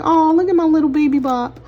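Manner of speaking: doting mom voice. Retold or imitated. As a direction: coo over the baby. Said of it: "I'm still gonna be like".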